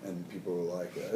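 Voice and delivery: ordinary delivery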